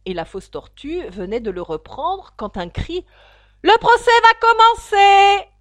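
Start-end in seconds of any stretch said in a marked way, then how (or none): 3.6-5.5 very loud high shout from far off; faraway herald calling; distant call. Acted. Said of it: "se fit entendre au loin".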